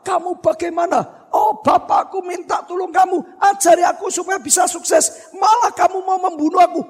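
high-pitched acted indignation; angry protest. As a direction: protest as the outraged boy. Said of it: "marah-marah".

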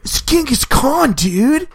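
high-pitched, excited surfer-dude voice; exaggerated high bro voice; Bill and Ted. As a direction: announce it like an amazed dude. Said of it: "might be Bill and Ted".

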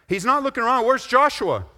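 high, loud call; playing Moses looking for Joshua; mock call across the camp.

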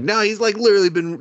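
high-pitched, incredulous objection; exasperated protest. Retold or imitated. Teller objecting to the scared heroine, exasperated protest. Mock protest at a doubting character.

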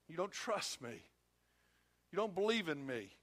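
soft, wounded reproach; hurt father. Acted. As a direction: plead softly as a let-down parent. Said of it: "It hurts".

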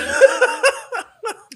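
high squeaky laughing fit; stifled giggles. Acted. Imitating the laugh.

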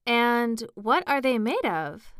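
intensely curious; great curiosity. A narrator's reading. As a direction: ask it with eager curiosity, stretching it out. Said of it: "in a tone of great curiosity".

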